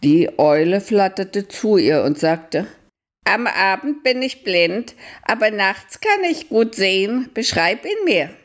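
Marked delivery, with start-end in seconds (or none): 3.2-8.3 high owl voice, calm; a high, patient old owl; wise owl. Acted. Owl speaks to Antonella. (hohe, ruhige Eulenstimme)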